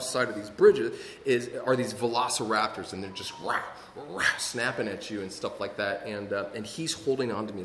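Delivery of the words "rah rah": quick mock raptor roar; imitated velociraptor snarl. Storyteller imitating raptors snapping at visitors, mock snarl.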